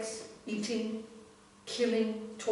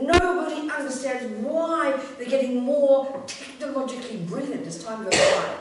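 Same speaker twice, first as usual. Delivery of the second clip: slow, loud, higher mimicry of amazement; hammed-up wildlife narrator marvelling at the ants; super-enthusiastic presenter; 5 semitones higher, 5 dB louder than usual. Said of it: "They're both super enthusiastic".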